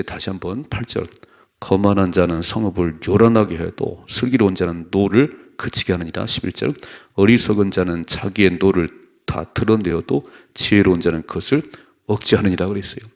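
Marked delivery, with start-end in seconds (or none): 1.6-6.3 loud, steady unison reading of the verse; scripture read aloud. Real, not acted. Pastor leads the congregation in reading, loud and steady. 7.1-12.7 loud, steady unison reading of the verse; scripture read aloud. Real, not acted. Pastor leads the congregation in reading, loud and steady.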